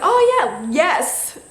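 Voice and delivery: high, loud retold yes; excited acceptance. Accepting his proposal late, excited. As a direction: re-enact a belated, eager yes.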